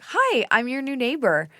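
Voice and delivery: high, drawn-out friendly hello; excitedly re-enacted introduction; excited greeting. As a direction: greet the new neighbor with eager, lifted pitch. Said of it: "so excited to have".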